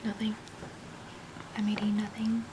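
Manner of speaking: hushed whisper, caught eating. Retold or imitated. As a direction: whisper it guiltily. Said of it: "She just stopped, like".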